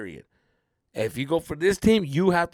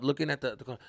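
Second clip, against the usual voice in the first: ordinary talk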